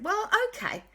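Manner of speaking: high, lively offer to help; cheerful friends. Acted. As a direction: chirp the plan brightly. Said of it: "And Quasi Cat and Dashy said".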